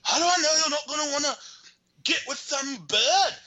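camp up the jealous lover, pitched high; squeaky soap-opera jealousy; mock jealous boyfriend. Boyfriend voiced in a soap recap.

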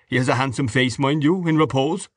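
mock the man with relish; coarse, mocking Mr Dedalus.

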